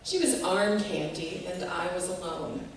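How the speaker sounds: ordinary delivery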